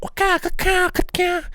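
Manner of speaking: high, squeaky cartoon fighting cry; boss battle noise. Boy mocks a boss-battle fight with squeaky high nonsense cries. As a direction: squeal it like a video-game boss.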